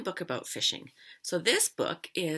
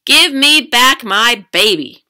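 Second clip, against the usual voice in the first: very loud, high, slow demand; big fish demanding; 7 semitones higher, 21 dB louder, slower than usual. A narrator's reading. Big fish demanding its baby back, very loud. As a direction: bellow it one word at a time.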